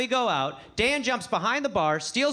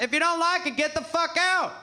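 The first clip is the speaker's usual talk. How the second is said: higher-pitched imitation of a shout; raised-voice mock outburst; mock heckler's shout; 5 semitones higher than usual. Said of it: "I've had people shout".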